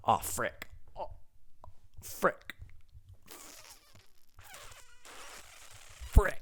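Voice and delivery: imitating husband. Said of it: "so he says".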